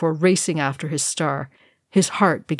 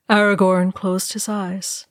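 ordinary delivery, nothing marked

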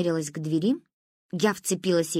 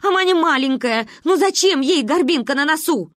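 higher, louder, pleading explanation; hastily backtracking; 7 semitones higher, 5 dB louder than usual. A narrator's reading. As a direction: rush to smooth it over. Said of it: "спохватилась мама".

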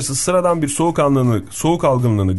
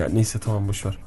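low, soft, giving up; dismissive man; 6 semitones lower, 7 dB quieter than usual. Acted. Man refusing medicine from his friend, dismissive. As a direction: let it drop quietly and low. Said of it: "Sen erkek yapıyor".

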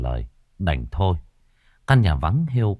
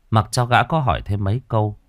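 plain reading voice

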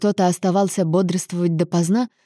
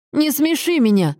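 higher-pitched dismissive jab; mocking, raised-voice retort; scornful interruption; 8 semitones higher than usual. A narrator's reading. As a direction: cut in with derision. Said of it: "снова перебил его Лоренцо".

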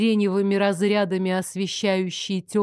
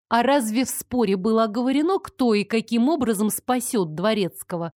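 ordinary delivery

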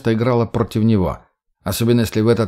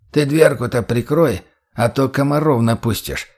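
put-on creaky old man; 2 semitones higher than usual. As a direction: croak it out like a frail old man. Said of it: "проскрипел из соседней комнаты голос деда".

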